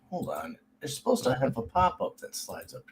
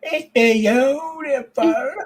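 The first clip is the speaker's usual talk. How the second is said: high, loud, drawn-out gushing; hammed-up high-pitched adoration; mock awe; 13 semitones higher, 6 dB louder, much slower than usual. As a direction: gush slowly in a high put-on voice. Mock gushing over artwork.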